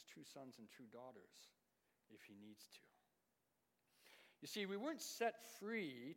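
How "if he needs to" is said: soft ominous whisper. Real, not acted. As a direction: let the warning fade to a whisper. Warning ends in whisper.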